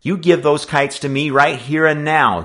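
loud, high-pitched threat; heated boyish ultimatum; threatening. A narrator's reading. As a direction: demand it with menace. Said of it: "he threatened".

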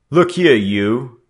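loud, slow and high, laying down the law; a street boss taking charge, drawn out; gang leader's authority. A narrator's reading. Gang leader to the captured boys, loud commanding voice. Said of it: "spoke with the authority of leader".

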